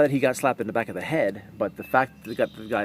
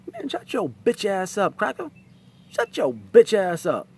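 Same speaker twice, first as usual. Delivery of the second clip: mock inmate; 8 semitones higher, 3 dB louder than usual. Imagined inmate shouting down sign holder.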